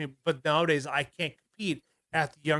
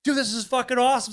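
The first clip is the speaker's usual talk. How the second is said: retold cheer, higher-pitched and moderately raised; imitated excited screaming; 7 semitones higher, 3 dB louder than usual. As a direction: mock-scream it like thrilled kids celebrating. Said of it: "all of us are screaming like".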